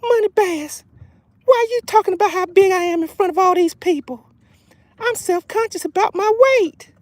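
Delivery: high-pitched voice of a self-conscious fish; talking fish. Acted. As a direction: speak up for the fish in a high falsetto. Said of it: "She wants to say something to me".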